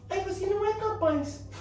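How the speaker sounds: high, thin-volume mocking voice; goofy high parody of self-criticism; changed silly voice. Shown as a demonstration. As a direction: tease the inner critic in a silly high pitch. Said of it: "Muda essa voz aí".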